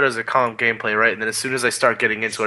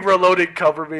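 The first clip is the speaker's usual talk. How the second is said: yelled soldier callout; 7 semitones higher than usual. Retold or imitated. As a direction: yell it mid firefight. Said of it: "I start screaming".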